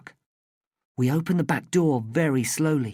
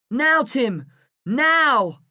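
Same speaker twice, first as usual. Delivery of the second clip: high, loud urgent call; shouting the cue across the road; 8 semitones higher, 4 dB louder, much slower than usual. A narrator's reading. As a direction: yell the signal to Tim. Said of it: "I shout".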